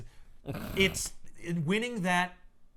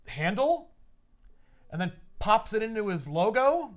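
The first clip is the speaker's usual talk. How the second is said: ordinary talk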